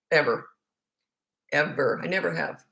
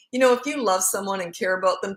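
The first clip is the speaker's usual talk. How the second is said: usual speaking voice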